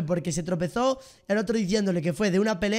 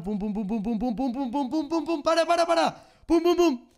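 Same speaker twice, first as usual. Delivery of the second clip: pum-pums climbing steadily higher into frantic stop pleas; frantic jabbing imitation; 6 semitones higher than usual. Retelling the swab being pushed in, mock frantic escalation. Acting out repeated painful swab jabs.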